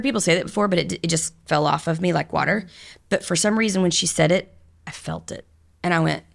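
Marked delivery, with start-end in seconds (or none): 4.8-5.5 whispered realization. Real, not acted. Realizing guru's words.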